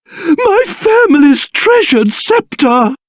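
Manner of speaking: normal talking voice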